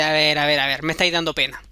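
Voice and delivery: the goblin taking pity on the weeping queen; low goblin voice. Acted. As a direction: drop the voice low and condescending.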